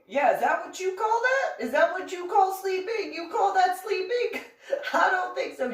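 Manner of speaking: high, mocking disbelief; sarcastic mom. Mom mocks daughter's excuse.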